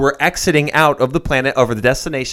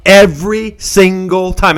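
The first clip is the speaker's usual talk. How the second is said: "every single time" slow, louder and higher; emphatic exasperation; 7 semitones higher, 6 dB louder, slower than usual. Real, not acted. Host complaining about a movie cliché, emphatically exasperated. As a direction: punch each word of "every single time".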